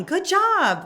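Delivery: high, loud cheer of "Good job"; yelling from the sidelines. Shown as a demonstration. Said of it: "I'm yelling".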